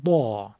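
"با" as a thickened emphatic d; heavy d. Shown as a demonstration. Teacher isolating the Egyptian Dad, heavy d. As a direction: say "با" with a full, heavy d. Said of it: "همون دال درشت شده".

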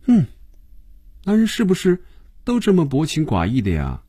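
high-pitched wistful complaint; sigh, then ask in a high voice. A narrator's reading. Phoebe lamenting that men are heartless, sighing complaint. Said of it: "轻轻地叹了口气".

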